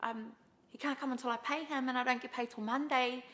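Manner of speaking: lightly mocked sister's excuse. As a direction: voice the sister spinning a sob story. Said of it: "And she's like".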